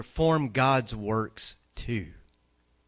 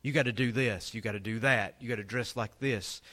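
normal talking voice

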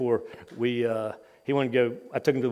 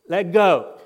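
put-on mildly raised, higher little-boy demand; lightly voiced child insisting, louder and higher; insistent small child; 4 semitones higher, 6 dB louder than usual. Small son tugging at dad's hand in the wave pool, insistent.

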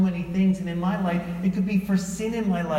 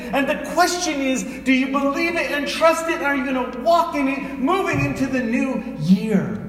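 higher, driving sermon climax; rising preacher's challenge; full-force preaching; 7 semitones higher, 4 dB louder than usual. Acted. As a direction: push the congregation to believe.

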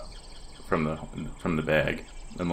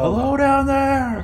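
high, slow call down into a hole; 7 dB louder, slower than usual. Acted. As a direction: call it down the shaft, stretched out. Calling down into the hole.

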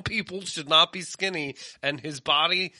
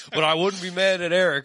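usual speaking voice

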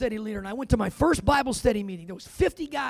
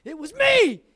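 shouted confession, a touch higher; 5 semitones higher, 6 dB louder, slower than usual. Retold or imitated. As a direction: blurt it out loud to the whole room. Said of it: "I was just like".

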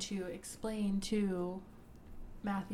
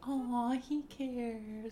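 higher, very slow and fond; tender aw; 4 semitones higher, much slower than usual. Real, not acted. Fond reaction to Zane.

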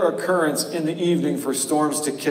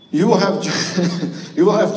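laughing through the reading; 4 semitones higher than usual. Real, not acted. Laughs over stumbled reading.

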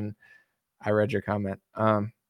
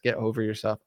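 ordinary talk

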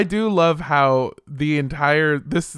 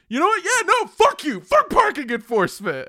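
defiant retort; 10 semitones higher than usual. Retold or imitated. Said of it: "and I just went".

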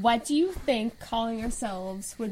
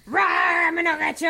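mock monster roar for kids; playful monster growl; 5 semitones higher, 5 dB louder, much faster than usual. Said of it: "I satisfy them by going".

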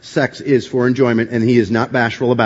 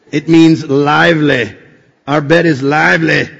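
loud, raised gravelly growl; 3 semitones higher, 5 dB louder than usual. Shown as a demonstration. Preacher shows congregation how to say the verse, gravelly voice. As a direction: belt it out with gravel in the throat. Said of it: "Get that gravelly voice".

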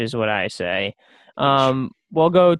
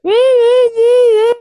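high squealing horn sound; horn noise; 9 dB louder than usual. Pretend. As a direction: blast a horn with your voice. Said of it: "I'm doing it with my mouth".

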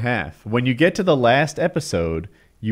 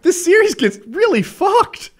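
shocked excitement; 12 semitones higher, 6 dB louder than usual. Retold or imitated. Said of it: "I'm like".

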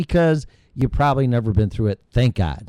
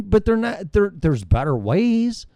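ordinary talk

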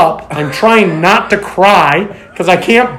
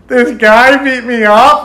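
high, slow whiny voice; boy melting down in his brother's van; retold whimper; 6 semitones higher, 6 dB louder, slower than usual. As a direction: whimper it like a kid. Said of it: "melted like a little pussy".

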